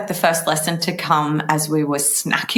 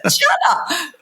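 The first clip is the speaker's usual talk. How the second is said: one word pushed out through laughter, high; laughing; 10 semitones higher than usual. Real, not acted. Echoing her friend's cheddar joke, laughing.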